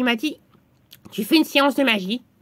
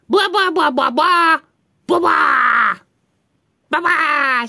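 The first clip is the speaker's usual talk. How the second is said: higher, rapid chanted spell; magic formula; 4 semitones higher, 4 dB louder, slower than usual. Acted. Two friends cast the spell on someone, chanted formula. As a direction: chant the syllables like an incantation. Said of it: "on va prononcer la formule magique".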